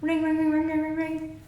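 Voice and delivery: high sung ring ring imitation; phone ringing noise.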